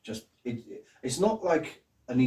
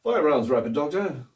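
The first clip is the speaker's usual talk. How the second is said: posh military officer, louder; the Brigadier; 3 semitones higher, 5 dB louder than usual. Acted. Auditioning as the Brigadier, posh commanding voice. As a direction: give the order in a posh officer's voice. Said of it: "just me doing a posh voice".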